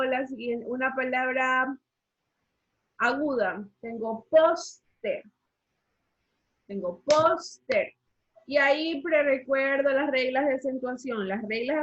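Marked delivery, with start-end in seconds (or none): 4.3-5.2 "póster" very slow, PÓS punched, then TER; stress on penultimate syllable. Shown as a demonstration. Teacher presenting an example word, slow stress on PÓS in "póster". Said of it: "en la penúltima sílaba". 7.0-7.9 "póster" very slow, PÓS punched, then TER; stress on penultimate syllable. Shown as a demonstration. Teacher presenting an example word, slow stress on PÓS in "póster". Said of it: "en la penúltima sílaba".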